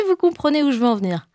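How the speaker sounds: usual speaking voice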